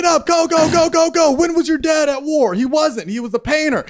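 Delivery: high, loud, hammed-up shouting producer; yelling producer rushing a sob story; frantic producer. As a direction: bark orders at a contestant in a panic.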